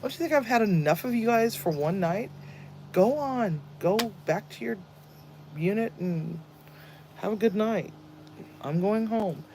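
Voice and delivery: mock tired officer sending them off; retold exasperated reply. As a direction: shoo them back to their unit. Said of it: "I'm like".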